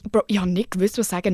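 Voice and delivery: usual speaking voice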